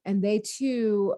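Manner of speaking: usual speaking voice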